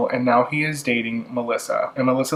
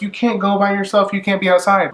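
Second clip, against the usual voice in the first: anxious caretaker; 7 semitones higher than usual. Retold or imitated. Erica scolds Rachel running away.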